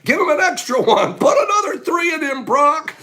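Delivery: high, loud, through laughter; laughing. Real, not acted. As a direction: joke while laughing. Laughing joke about dosing.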